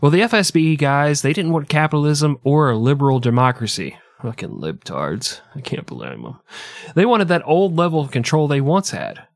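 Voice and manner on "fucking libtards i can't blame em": much lower and softer, under the breath; muttered aside. Acted. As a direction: throw it away under your breath.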